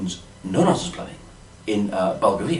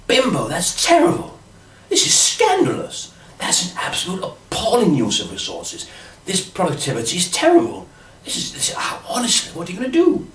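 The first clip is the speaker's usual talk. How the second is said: mock outrage; 11 semitones higher, 3 dB louder than usual. Outraged at wasteful motorway spending.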